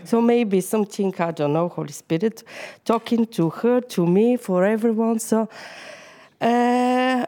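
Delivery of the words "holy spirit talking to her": normal talking voice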